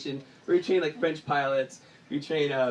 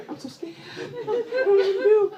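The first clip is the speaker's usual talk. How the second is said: high, whiny mock panic; fake-whimper your fear; mock scared; 13 semitones higher than usual. Panicked after the slip.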